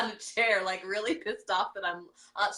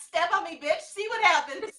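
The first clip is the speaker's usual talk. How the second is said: high, louder cat threatening its owner; tough cat voice; 8 semitones higher, 4 dB louder than usual. Retold or imitated. Host voicing her cat daring her, high mock threat. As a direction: play the cat daring you. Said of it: "And then you go".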